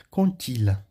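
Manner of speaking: "Contilha" joined up, D sounded as T; French liaison. Shown as a demonstration. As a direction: link "Contilha" as kon-til-a with a clear T. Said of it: "vai ter som de T".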